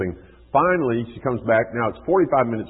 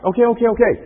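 much higher and louder, scrambling okays; hurried okays; 8 dB louder, faster than usual. Retold or imitated. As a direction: give in fast like a startled kid. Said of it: "So now he drops it".

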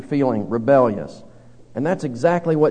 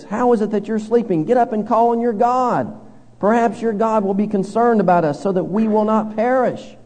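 pleading captain; 6 semitones higher than usual. Retold or imitated. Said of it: "Verse six".

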